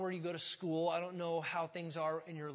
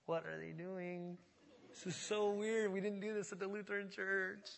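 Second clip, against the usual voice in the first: recalling his first confused look at raised hands; baffled newcomer; 2 semitones higher than usual. Retold or imitated. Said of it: "I remember just being like".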